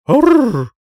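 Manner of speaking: high, loud rolled growl; dragon roar. A narrator's reading. Heroine miming a dragon for the prince, growling. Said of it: "Присела на корточки и сделала".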